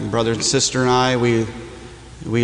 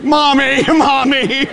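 much higher, louder whiny Mommy call; mock child crying; 10 semitones higher, 3 dB louder, slower than usual. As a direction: play a homesick little kid, laughing. Mock crying for his mom.

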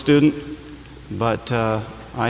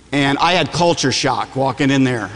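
ordinary talk, nothing marked

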